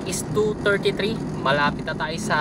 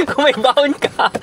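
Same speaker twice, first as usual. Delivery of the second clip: laughing through the words; 8 semitones higher, 5 dB louder than usual. Real, not acted. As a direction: repeat it while still laughing. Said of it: "pasensya na, dumi ng kuko ko".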